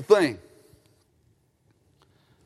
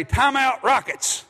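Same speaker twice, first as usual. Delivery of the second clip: announcer call; 6 semitones higher than usual. Retold or imitated. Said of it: "sometimes Bill will say".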